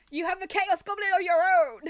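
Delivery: loud, high shout through laughter; laughing shout. Real, not acted. Game master retorting to a complaining player, laughing shout. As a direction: shout it back, cracking up.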